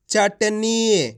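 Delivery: चटनी very slow, with the final ee held; drawn-out reading. Shown as a demonstration. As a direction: stretch out चटनी syllable by syllable. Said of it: "खींच खींच के पढ़ना है".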